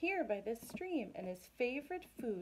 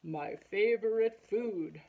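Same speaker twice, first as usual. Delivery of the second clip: slow, louder Gruffalo voice; hungry monster; 6 dB louder than usual. Acted. Gruffalo sizes up the mouse as food, hungry monster. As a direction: relish the meal in a slow monster voice.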